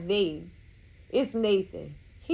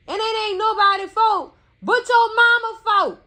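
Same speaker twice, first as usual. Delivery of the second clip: much higher and louder indignant rant; shrill-free loud outburst of blame; heated outburst; 9 semitones higher, 7 dB louder than usual. Real, not acted. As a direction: blow up at him over his mama. Blaming his mama in a rant.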